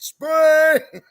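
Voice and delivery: imitating Michael's high, loud spring cheer; mock excited yell. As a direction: yell it like an impatient kid. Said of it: "He's like".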